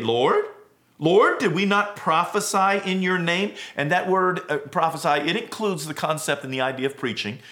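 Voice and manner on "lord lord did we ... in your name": rising, slowed plea of Lord, Lord; pleading. Acted. The rejected plead with their Lord at the gate, rising and pleading. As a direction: beg to be let in, voice climbing higher and louder. Quoting pleaders before Jesus.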